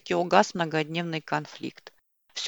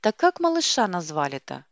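ordinary delivery, nothing marked

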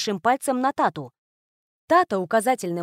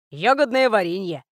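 Puzacho's voice. A narrator's reading. Puzacho offers jam.

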